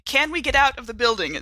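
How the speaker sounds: ordinary delivery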